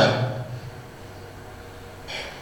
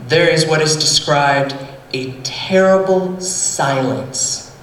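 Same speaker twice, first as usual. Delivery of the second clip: ordinary delivery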